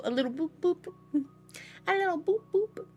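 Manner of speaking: high little sound-effect boops; playful boops. Pretend. Reader joking about speeding a little, playful. Playful noises for nudging the gas.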